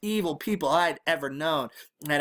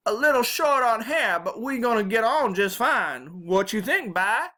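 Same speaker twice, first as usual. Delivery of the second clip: put-on high, lively old granny voice; Southern grandma; 8 semitones higher, 4 dB louder than usual. A narrator's reading. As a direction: size up the grandson as a bossy granny.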